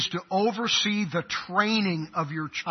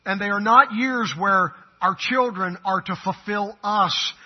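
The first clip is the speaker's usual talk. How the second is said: ordinary talk, nothing marked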